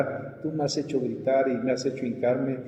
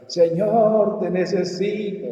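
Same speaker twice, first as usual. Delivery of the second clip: high, drawn-out wailing plea, growing louder; grito lloroso imitado; 8 semitones higher, 7 dB louder, much slower than usual. Retold or imitated. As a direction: imitate someone crying out desperately to God. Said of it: "oía que alguien gritaba, alguien lloraba". (clamor a gritos, imitando llanto)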